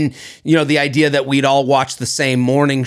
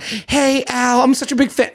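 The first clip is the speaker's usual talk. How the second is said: gushing fan; 6 semitones higher than usual. Retold or imitated. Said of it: "I wouldn't go up to him and be like".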